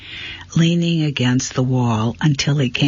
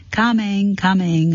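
muffled reply; 7 semitones higher than usual. A narrator's reading. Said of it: "a muffled voice replied".

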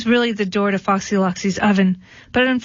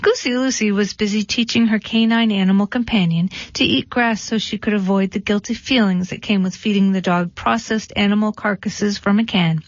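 ordinary delivery, nothing marked

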